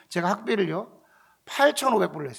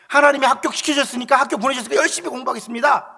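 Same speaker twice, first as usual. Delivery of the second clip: loud, higher-pitched pledge; emphatic vow; 5 semitones higher, 6 dB louder than usual. Retold or imitated. New student promising God to study hard, emphatic. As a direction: declare it forcefully, as a retold promise.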